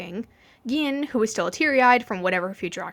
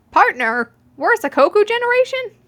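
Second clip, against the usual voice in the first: much higher and louder, incredulous; disbelief; 12 semitones higher, 7 dB louder than usual. A narrator's reading. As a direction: squeak it out in total shock. Stunned past self reacts.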